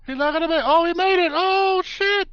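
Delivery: high, loud excited reaction. Real, not acted. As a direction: shout it out as the move lands. Reacting live to wrestling match.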